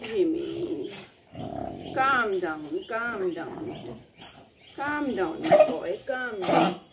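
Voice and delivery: low, soft soothing to the dog. Real, not acted. Mother calming her excited dog, soft and soothing. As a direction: settle him gently, softly.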